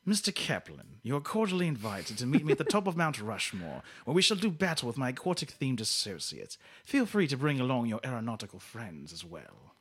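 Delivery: put-on theatrical villain's James Mason accent. Host repeats the clue for giggling contestant, James Mason accent. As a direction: deliver a grand challenge in a James Mason accent. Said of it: "that accent makes me giggle".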